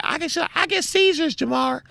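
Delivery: high falsetto grievance; mock whiny woman's voice; mimicked complaining friend. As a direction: plead high-pitched, imitating her. Acts friend pleading about seizures.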